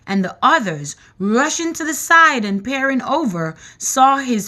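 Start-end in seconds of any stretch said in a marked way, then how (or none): none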